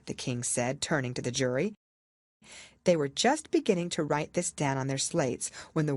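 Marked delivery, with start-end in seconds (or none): none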